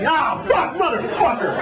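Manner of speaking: high mock outburst of cursing; high, laughing mock fury; mock furious curse. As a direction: curse high like a stranded rider. Passengers losing it.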